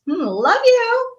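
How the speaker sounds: high, slow, snide sing-song; spiteful. Shown as a demonstration. To someone ticking her off, a high spiteful love you. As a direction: drip sarcasm on every word. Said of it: "in a spiteful way".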